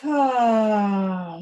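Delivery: long open "ah", falling away; audible exhaling sigh. Shown as a demonstration. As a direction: sigh it all out through the mouth. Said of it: "Out through the mouth".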